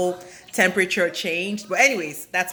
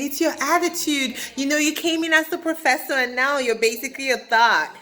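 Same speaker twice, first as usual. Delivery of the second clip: lightly imitated nagging, pitched up; mock accusation; 6 semitones higher than usual. Castmates accusing the professor over attitude, mildly mocking and high. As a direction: accuse in a higher put-on voice.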